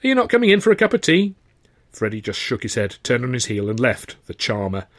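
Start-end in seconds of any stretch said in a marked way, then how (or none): 0.0-1.4 high, quick friendly call; calling after. A narrator's reading. Landlady calling after Freddy, high and quick. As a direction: call after the departing lad.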